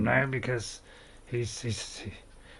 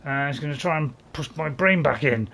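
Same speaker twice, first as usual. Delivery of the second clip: usual speaking voice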